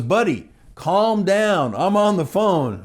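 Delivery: high, slow mock scolding; exasperated telling-off. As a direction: stretch it out, telling a stranger off. Irritated caller tells him off.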